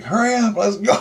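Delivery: high, loud urgent call; shouting to hurry out of the smoke. Retold or imitated. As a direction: rush her out, voice up high. Urging partner in smoky dream.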